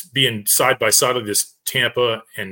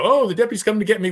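high, quick and delighted; a light child's voice, eager; happy little girl; 9 semitones higher, faster than usual. Retold or imitated. Rescued girl happy the deputy is coming, high and quick. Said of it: "you know".

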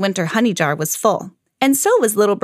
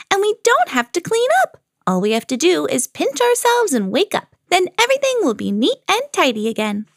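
high, excited bird voice; high-pitched storybook delight; whooping little bird; 9 semitones higher than usual. A narrator's reading. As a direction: rattle on gleefully, pitched up. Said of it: "whooped Little Bird".

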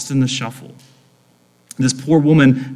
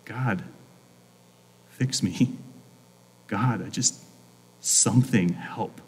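hushed, halting plea with long pauses; a small, desperate plea to God; lonely prayer; 4 semitones lower, 10 dB quieter than usual. Acted. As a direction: pray it low and soft, almost to yourself. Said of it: "we can almost, like, hear her prayer".

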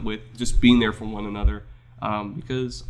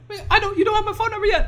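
much higher, squeaky little voice; squeaky mock voice; faster than usual. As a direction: play a needy kid squeaking. Mock voice of a clingy friend.

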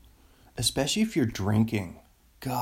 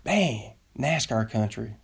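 high-pitched mock Southern nascar fan; drawn-out Southern fan call, breaking into laughs; Southern accent; 6 semitones higher, slower than usual.

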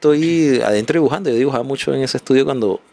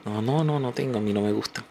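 put-on old lady refusing softly; 9 dB quieter than usual.

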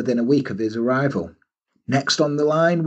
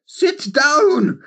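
high barked order, louder; Trunchbull bark; 12 semitones higher, 3 dB louder than usual. Pretend. Trunchbull ordering the nervous suitor to sit, high bark. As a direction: bellow like a tyrant headmistress. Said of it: "Missus Trunchbull shows that".

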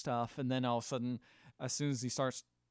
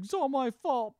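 high, loud woe-is-me wail; sad Shaggy; 12 semitones higher, 5 dB louder than usual. Retold or imitated. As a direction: imitate a sorry Shaggy, pitched way up. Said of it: "Shaggy starts being sad".

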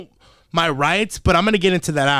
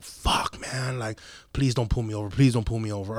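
low, soft, hurried worry; muttered inner plea; 5 semitones lower, 7 dB quieter than usual. Retold or imitated. Driver silently begging the cop behind him, muttered low and soft. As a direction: mutter it quietly to yourself, pleading.

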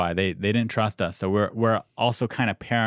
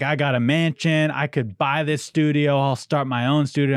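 boastful bragging; 6 semitones higher, 3 dB louder than usual. Retold or imitated. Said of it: "then he's like".

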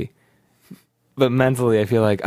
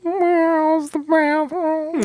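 very high, slow kitten voice; cat voice; much slower than usual. Pretend. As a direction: ask it like a timid cat. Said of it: "do it where he's, he's, uh, he's, he's asking, uh, where the bathroom is".

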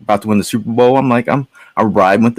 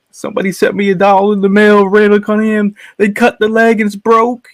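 mock crying child, high pitched; crying kid; 12 semitones higher, 3 dB louder than usual. A boy telling his dad about the broken doll, in a high mock wail. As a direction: whine it high like a heartbroken kid. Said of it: "I'm like".

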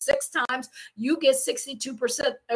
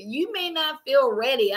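normal talking voice

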